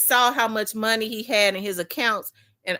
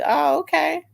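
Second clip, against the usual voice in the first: mock acceptance; 7 semitones higher, 3 dB louder than usual. Her reply to dad.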